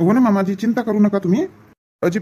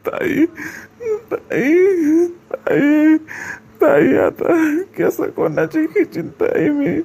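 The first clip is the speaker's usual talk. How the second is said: crying brother; 7 semitones higher, much slower than usual. Acted. Young brother calling to his departing sister, sobbing. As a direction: cry out to a sister who is leaving for good.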